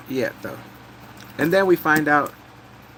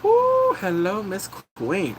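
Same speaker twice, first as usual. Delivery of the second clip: high whoop then slow flirt; admiring whoo; 5 semitones higher, slower than usual. Retold or imitated. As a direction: catcall her beauty playfully. Admiring gorgeous queen.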